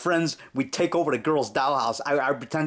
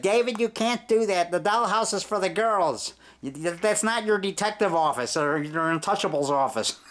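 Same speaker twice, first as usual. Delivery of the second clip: higher scolding teacher voice; imitating the teacher; 5 semitones higher than usual. Teacher catches David in the dollhouse, higher mock scolding. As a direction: mimic her telling David off. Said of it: "She said".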